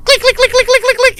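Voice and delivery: high, loud rapid clicking; demon clicking. Retold or imitated. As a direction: click like a skittering creature.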